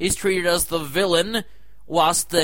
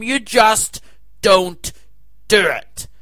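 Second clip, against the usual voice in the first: louder, punching don't; ranting at the censors, louder and louder; emphatic rant; 3 semitones higher, 4 dB louder, slower than usual. Real, not acted. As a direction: hammer each word home.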